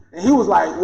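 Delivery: ordinary talk, nothing marked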